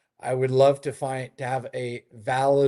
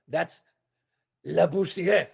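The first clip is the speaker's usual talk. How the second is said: imitating a Frenchman's higher-pitched correction; French accent; 5 semitones higher, slower than usual. French gentleman correcting the owner's pronunciation, imitated French accent. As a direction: say Le Boussière in a put-on French accent. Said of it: "with his, with his accent".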